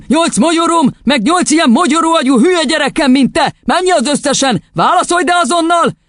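high, furious ranting; yelling tirade. A narrator's reading. Headmistress drilling the boy on fruit, ranting furiously. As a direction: berate the pupil relentlessly. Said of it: "üvöltött rá".

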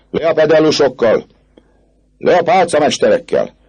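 high protest shout; crowd jeering. Acted. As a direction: cry "down with them" like a rioter. Crowd yells against officials.

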